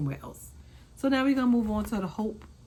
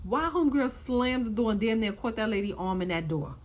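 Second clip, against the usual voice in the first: ordinary talk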